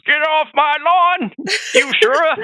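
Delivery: hammed-up old man hollering at kids; cranky old man. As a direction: scold the whippersnapper off your lawn. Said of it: "He's like".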